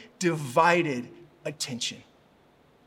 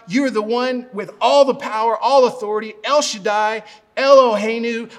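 loud, grand prayer piling up holy titles; big-words prayer; 7 dB louder than usual. Retold or imitated. Father models healing prayer for Macy, loud and grand. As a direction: pray big, calling out every name of God. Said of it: "I'm just calling all the names ... all the big words".

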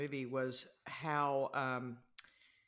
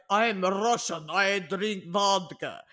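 put-on slow, louder, dumbed-down Russian accent; mock Russian accent; 3 semitones higher, 7 dB louder, much slower than usual. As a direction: ham up a broken Russian accent, slowly. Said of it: "kind of accent".

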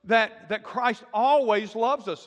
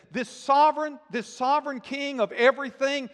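raised, ringing proclamation of the sovereign king; a preacher's raised declaration; proclaiming; 5 semitones higher, 5 dB louder than usual. Retold or imitated. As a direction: announce it higher and louder, with awe. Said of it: "He says".

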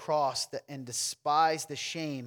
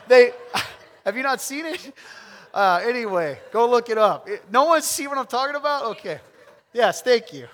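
loud call-out; 7 semitones higher, 12 dB louder than usual. Real, not acted. Preacher laughing, calling out to crowd.

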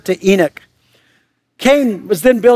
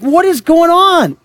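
mock bewildered; 7 semitones higher than usual. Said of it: "You're like".

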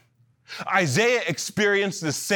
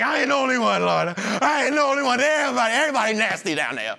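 tattling; 3 semitones higher than usual. Retold or imitated. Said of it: "he ratted everybody out".

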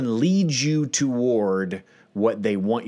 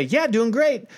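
forced cheerful reply; 13 semitones higher than usual. Retold or imitated. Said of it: "They want you to say".

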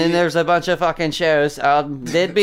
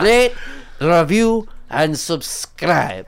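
slow sign-off; slower than usual. Acted.

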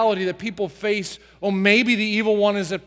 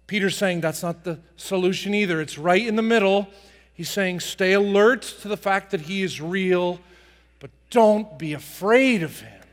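ordinary delivery

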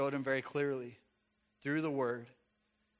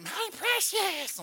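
put-on creepy old man's high, loud rasp; Smeagol; 6 dB louder, much slower than usual. Preacher mocking how people imagine the devil speaks, creepy creature voice. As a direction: screech it slowly, like a creepy creature. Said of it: "old creepy man's voice, or like Smeagol".

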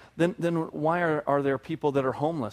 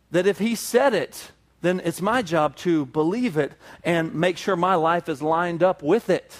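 usual speaking voice

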